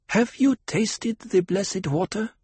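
put-on high, slow girlish question; a girl priestess asking solemnly; young girl voice. A narrator's reading.

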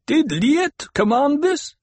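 high, slow, demanding question; challenging call. A narrator's reading. Voice from rocks challenges.

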